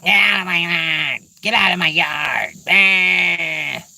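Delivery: mock yelling. Squirrel yelling from the crotch of a tree, cranky mock shout. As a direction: imitate a cranky squirrel shouting people away. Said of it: "yelling it".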